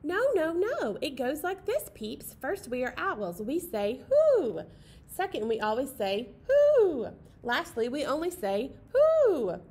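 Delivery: loud, lecturing with piercing high hoots; big brother owl schooling his little sister, loud and bossy. Acted. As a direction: lecture her like a know-it-all sibling.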